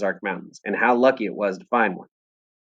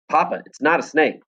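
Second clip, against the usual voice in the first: loud voice; 6 semitones higher than usual. Acted. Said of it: "I said in a loud voice".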